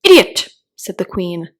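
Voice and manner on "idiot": loud, high, impatient snap; impatient insult. A narrator's reading. Queen insulting the bowing knave, impatient and loud. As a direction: spit it out in royal contempt. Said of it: "tossing her head impatiently".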